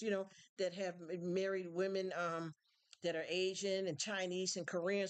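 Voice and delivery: ordinary delivery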